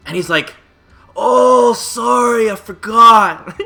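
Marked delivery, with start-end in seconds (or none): 1.2-3.6 higher, louder, slow goofy clerk voice; dopey clerk. Retold or imitated. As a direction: play the clueless clerk, drawn out. Clerk voiced, forgot price match.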